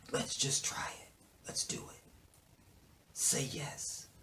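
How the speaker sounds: urgent whisper; whispered plea retold. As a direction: coax them in a hush. Whispered retelling of pleading.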